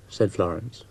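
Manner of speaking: plain reading voice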